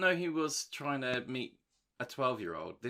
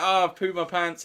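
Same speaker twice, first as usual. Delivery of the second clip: higher, louder whining voice; mock whine; 6 semitones higher, 8 dB louder than usual. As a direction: whine like a man writhing on the floor. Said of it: "going like".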